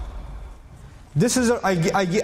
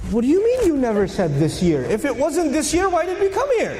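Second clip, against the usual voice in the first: climbing higher in disbelief; indignant protest; 6 semitones higher than usual. Acted. Companion objecting to the Prophet, indignant and rising. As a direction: push the question up, outraged.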